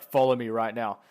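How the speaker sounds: loud, lightly acted angry order; pissed-off teacher. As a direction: imitate a cross teacher giving orders. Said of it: "really pissed off".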